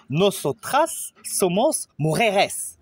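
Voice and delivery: slow, clear Spanish example for learners; teacher models the feminine form slowly, stressing nosotras; slow model phrase. Shown as a demonstration. Teacher shows the feminine form: slow and clear. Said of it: "remplacer os par la terminaison as".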